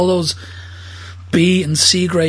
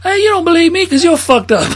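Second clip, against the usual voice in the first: loud, much higher disbelief; mock argument; 12 semitones higher, 5 dB louder than usual. Drunk denying accusations, loud high mock quarrel.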